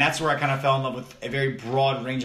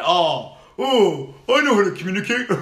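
high, laughing mock; 6 semitones higher, 6 dB louder than usual. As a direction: sneer it like the critics do. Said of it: "A lot of people catch flack that".

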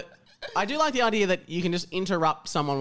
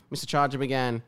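ordinary delivery

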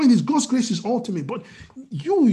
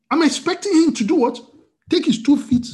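ordinary delivery

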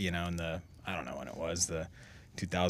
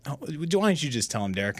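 a touch higher, teasing emcee; lightly voiced emcee prodding Derek; mock emcee nudge; 7 semitones higher than usual. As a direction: needle him like a ring announcer. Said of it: "he'd go".

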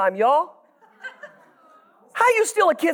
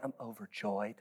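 very low and quiet, no joy at all; deadpan mumble; 21 dB quieter than usual. Retold or imitated. Churchgoer mumbling overjoyed with no joy, flat and hushed. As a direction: drone the word overjoyed as if bored.

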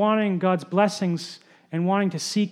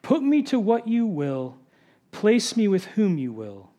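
reverent prayer reading. Acted. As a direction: read it as a solemn vow, hushing toward the end. Reads a covenant prayer aloud.

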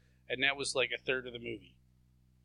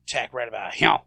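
lightly put-on southern accent, lower pitch; low imitation of a southern accent; mock southern accent; 5 semitones lower, 5 dB louder, faster than usual. Imitating Seagal planning an attack, mock southern accent. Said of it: "he has, like, a southern accent".

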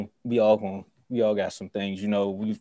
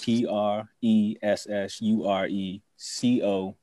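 spelled out slowly; slower than usual. Shown as a demonstration. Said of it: "spells out the handle".

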